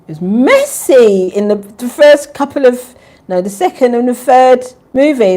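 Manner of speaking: loud and high, rising on messy; excited loud exclaim. Real, not acted. Vlogger to viewers about the Twilight films, loud excited exclaim. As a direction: shout it out, scandalised by the drama.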